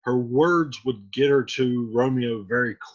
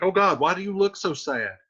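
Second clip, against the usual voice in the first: quick, higher modern Juliet; fast, higher, mildly exasperated girl; paraphrased Juliet; 8 semitones higher, faster than usual. Retold or imitated. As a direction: rattle it off like an impatient teen. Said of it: "So she's like".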